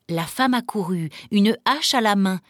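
usual speaking voice

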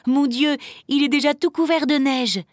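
high-pitched astonished cry; exclaiming. A narrator's reading. Emma finding the tree under snow, exclaiming. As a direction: exclaim over what you just found. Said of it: "s'écria Emma".

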